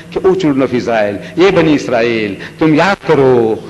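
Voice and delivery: usual speaking voice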